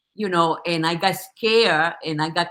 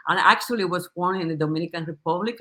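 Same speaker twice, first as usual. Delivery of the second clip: usual speaking voice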